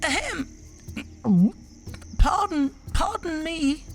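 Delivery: high little mouse clearing his throat politely; timid mouse. Acted. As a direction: nervously wake the lion. Mouse waking the lion.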